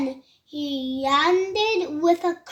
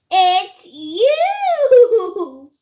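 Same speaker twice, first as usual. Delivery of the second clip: loud, high whoop breaking into laughter; laughing reply; 4 semitones higher, 7 dB louder than usual. A narrator's reading. Duckbills answer him by the river, laughing. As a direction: laugh out the last word, long and loud. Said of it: "and they laughed and said".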